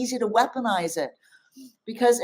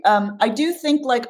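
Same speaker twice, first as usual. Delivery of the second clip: ordinary talk, nothing marked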